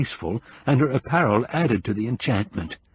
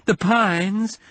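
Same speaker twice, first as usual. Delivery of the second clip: pained woman; 10 semitones higher than usual. Acted. Bitten woman answers in pain.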